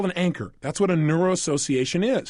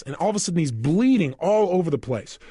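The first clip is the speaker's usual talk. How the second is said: usual speaking voice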